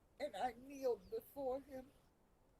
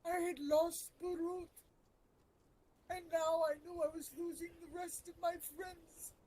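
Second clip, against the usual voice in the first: grieving, pitched up; raw grief; 7 semitones higher, 4 dB louder than usual. Real, not acted. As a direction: let the loss break through the words. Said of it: "I'm getting so emotional".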